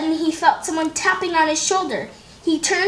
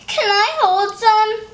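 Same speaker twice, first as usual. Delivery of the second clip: high, slow, sweet asking; eager little girl; 7 semitones higher, slower than usual. Acted. Beatrice asks her brother to hold books, high and slow. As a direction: ask like a little kid wanting to help. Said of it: "Beatrice asked".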